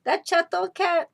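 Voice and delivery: tiny mouse convincing himself; a hint of squeaky mouse; papa mouse. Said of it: "Papi said".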